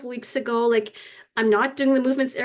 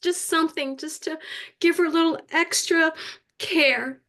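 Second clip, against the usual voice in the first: higher, halting voice full of tears; tearful; 4 semitones higher than usual. Real, not acted. Waitress sharing how her heart felt for a lonely lady, tearful. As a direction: still choked up, working to keep talking.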